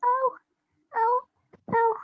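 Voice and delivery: high mock whimpers of pain; pretend crying. Girl acting her hurt jaw, pretend crying. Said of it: "So I was crying a lot".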